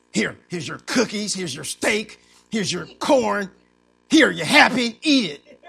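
building to a loud, resentful shove of food; mock grudging anger. Grudging giver slams down food.

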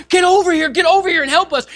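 high, loud call across water; urgent beckoning. Acted. Preacher as Peter beckoning James and John, urgent shout. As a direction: summon your partners over in a hurry. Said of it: "he's beckoning to James and John".

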